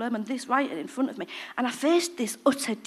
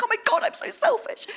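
high, hammed-up cry of revelation; self-mocking melodrama; mock dramatic wail; 13 semitones higher than usual. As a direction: overplay your own big discovery. Repeating the revelation, mocking herself.

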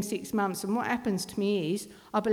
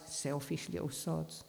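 low-key, much softer, slow mutter; muttered inner thought; 3 semitones lower, 11 dB quieter than usual. Retold or imitated. Mother's head talking about her kids, slow quiet mutter. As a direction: mutter it slowly under your breath.

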